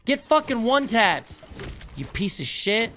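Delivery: high loud gloating yell; taunting shout. Real, not acted. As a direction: scream the taunt in triumph. Taunting a killed enemy.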